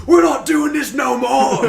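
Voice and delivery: loud, high, slow British orc voice; British accent. Pretend. Orc turning on his master, loud British accent. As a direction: yell it like a furious British orc. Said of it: "he had a super fucking British accent".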